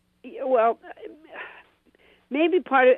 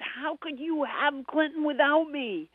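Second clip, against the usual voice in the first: higher, slow, aggrieved protest; upset complaint; 7 semitones higher, 4 dB quieter, slower than usual. Retold or imitated. [name] retelling her complaint to the boss, mock indignant. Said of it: "And I was so upset".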